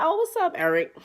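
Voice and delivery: high, sing-song fake-friendly hello; falsetto fake greeting. Teller answering Eric's call in a high fake-sweet voice. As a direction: greet him sweetly with no interest. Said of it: "I'm like".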